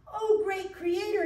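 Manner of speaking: high, slow joyful call. Acted. Mouse who can fly calls to the creator, joyful. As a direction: call up to the sky with joy. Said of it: "he called out".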